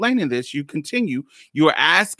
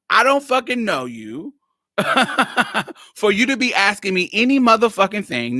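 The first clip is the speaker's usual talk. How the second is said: pissed off; 5 semitones higher than usual. Retold or imitated. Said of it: "Now I'm cussing, so you know I'm pissed off".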